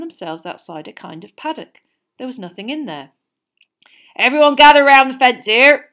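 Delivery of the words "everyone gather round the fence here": loud hearty call; booming giant gathering the class. A narrator's reading. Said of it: "he called".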